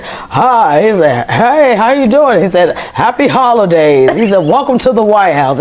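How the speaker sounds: a light take on a relaxed, friendly president's greeting; Obama impression redone. Shown as a demonstration. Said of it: "you got a good Obama impression".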